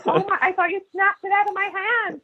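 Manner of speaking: high-pitched imitation of a shocked host; mock incredulity. Comedian retells the host's reaction to a refused drink token, mock incredulous.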